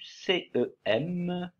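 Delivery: C E M said slowly, letter by letter; spelling out letters. Shown as a demonstration. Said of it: "c'est pour faire le son en de décembre". (épeler C E M lentement)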